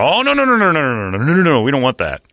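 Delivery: high, rising wave of noes; emphatic refusal. Retold or imitated. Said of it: "corral that and go".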